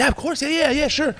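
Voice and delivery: very high, eager-to-please chain of yeses; eager to impress. Retold or imitated. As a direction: play the over-agreeable employee. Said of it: "trying to press your boss".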